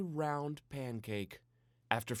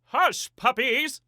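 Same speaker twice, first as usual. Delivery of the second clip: much higher and louder, commanding; ordering; 13 semitones higher, 14 dB louder, slower than usual. A narrator's reading. Daddy telling the dogs to hush, in a loud high order. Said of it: "Daddy ordered".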